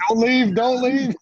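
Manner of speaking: high, loud, slow hammed-up begging; mock cry to stay; mock pleading. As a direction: join in with comic desperation. Listener joins mock plea.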